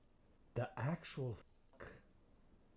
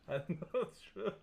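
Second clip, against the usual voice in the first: higher, rising, just after a laugh; laughing, higher-pitched evasion; amused dodge; 3 semitones higher than usual. Real, not acted. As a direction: repeat it with the pitch climbing, amused.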